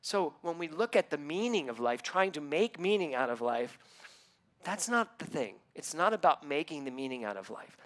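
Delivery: measured, earnest preaching; inspirational sermon. Acted. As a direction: deliver it like a spiritual lesson. Preaching on the meaning of life.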